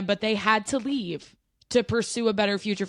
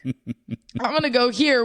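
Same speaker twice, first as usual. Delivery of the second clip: slow, louder, laughing airhead voice; mock carefree partygoer; 2 semitones higher, 5 dB louder, slower than usual. Mocking a protester heading to their favorite bar, laughing. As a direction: lampoon the oblivious protester.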